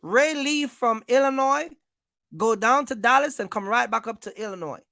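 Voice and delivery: usual speaking voice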